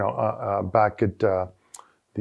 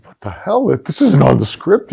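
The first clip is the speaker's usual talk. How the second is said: retold surprise; 4 semitones higher, 4 dB louder than usual. Guys in hall reacting.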